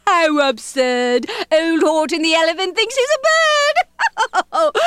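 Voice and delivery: high, jeering tease; taunting yell. Acted. Friends mocking Horton, taunting yell. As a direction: jeer it high like teasing friends. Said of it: "they teased him, they yelled".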